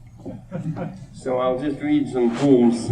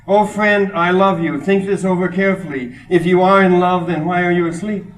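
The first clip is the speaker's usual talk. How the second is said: raised, forceful poetry reading; poem recitation; 6 semitones higher, 7 dB louder than usual. Acted. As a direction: declaim it to the room, high and loud. Reads a Kabir poem aloud.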